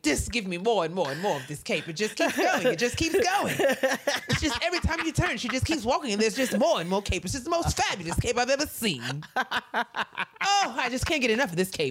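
high, breathless gushing over the cape, laughing through it. Retold or imitated. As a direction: rave about the cape in a high swooning voice. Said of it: "He was like".

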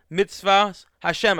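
usual speaking voice

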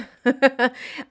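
short giggle through a stuffy nose; embarrassed giggle. Real, not acted. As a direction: laugh it off, a bit sheepish. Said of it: "stuffy nose".

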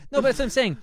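high, loud put-on Ezra Miller voice; Ezra Miller impression. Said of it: "That's my Ezra Miller impression".

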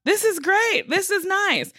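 high, gushing mock delight. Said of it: "and I'm like".

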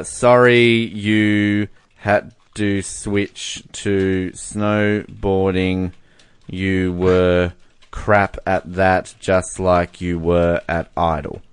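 very slow, word by word while typing; low, drawn-out composing aloud; slow dictation. Real, not acted. Host writing to the Idol runner-up, slow dictation.

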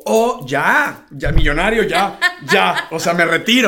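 loud, laughing, thrilled; bursting with joy at the first sales; excited. Retold or imitated. To himself over the first sales, loud laughing joy.

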